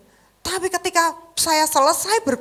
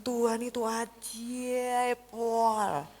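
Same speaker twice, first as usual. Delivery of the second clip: slow awed praise; 9 dB quieter, much slower than usual. Real, not acted. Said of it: "Saya bilang".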